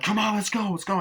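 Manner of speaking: high mock rallying shout; urgent calling out. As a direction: act out hurrying the crew along. Acted urgent call to others.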